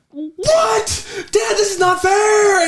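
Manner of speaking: put-on high, loud whining child's protest; whiny kid voice. Dad recounts his son wailing at him. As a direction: squeal it like an upset little boy. Son upset at baseball game.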